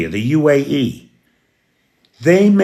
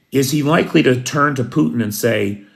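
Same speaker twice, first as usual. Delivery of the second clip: normal talking voice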